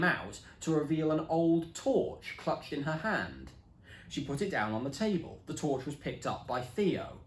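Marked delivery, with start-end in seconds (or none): none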